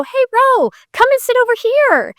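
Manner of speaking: high, bright call across the room. Retold or imitated. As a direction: wave her over with a high friendly call. Retold friend calling her over.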